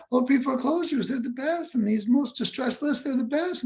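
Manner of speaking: high, mocking gushing voice; mock enthusiast. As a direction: parody naive excitement. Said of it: "people go".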